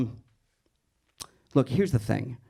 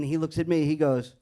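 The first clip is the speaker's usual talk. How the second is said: ordinary talk, nothing marked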